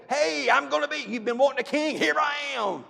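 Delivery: high, loud boastful crowing; hammed-up gloating to the whole town; mock bragging shout. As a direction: shout it like a show-off announcing himself. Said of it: "I'd be going through".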